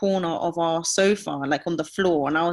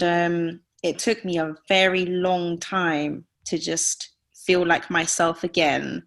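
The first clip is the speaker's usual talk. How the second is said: ordinary talk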